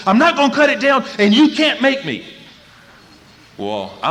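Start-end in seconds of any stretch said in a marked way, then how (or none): 0.1-2.2 high, loud shouted refusal; explosive defiant yell; in-your-face defiance. Retold or imitated. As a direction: imitate a furious son yelling up close. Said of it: "his nose about that far from my face".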